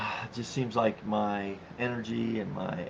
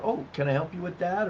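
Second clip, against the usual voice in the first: polite pointing out; 7 semitones higher than usual. Retold or imitated. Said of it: "we are to politely just point out".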